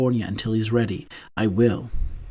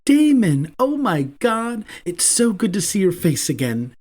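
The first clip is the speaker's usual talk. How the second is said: excited exclaim; 7 semitones higher than usual. A narrator's reading. Abel overjoyed to see Damon on the phone, high excited exclaim. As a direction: burst out with delight at seeing him. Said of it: "Abel exclaims".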